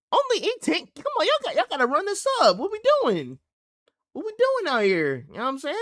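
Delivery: high, loud, fast hype-up; excited hype. Real, not acted. Hyping the young artist.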